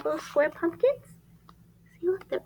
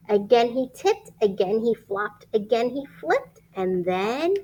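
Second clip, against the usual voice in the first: lively rhyming storybook read; reading to children; 4 semitones lower, 4 dB louder than usual. Acted. Reading a rhyming picture book aloud to children. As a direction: read it like bedtime story time.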